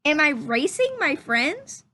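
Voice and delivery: lively, curious rising questions; questioning read-aloud voice. Acted. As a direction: read each question like a wondering child. Reads story questions aloud.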